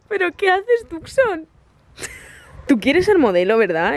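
high, sing-song pet voice; talking to the dog. Real, not acted. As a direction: coo at the dog. (voz aguda para el perro)

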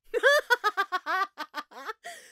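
long high loud rising cackle; maniacal laugh. Acted. Mad scientist to her test subject, long loud maniacal laugh. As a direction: let the madness out in a climbing laugh. Declares herself insane, then laughs.

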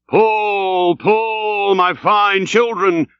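high, long drawn-out coaxing; drawling, soothing. A narrator's reading. Stubb urges his crew to pull, drawling soothingly. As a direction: stretch each word to coax the rowers. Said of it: "drawlingly and soothingly sighed Stubb".

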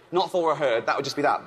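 usual speaking voice

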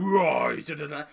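loud roar tailing into nonsense syllables; mock monster roar. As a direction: roar like the monster you expected. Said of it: "I thought he was going".

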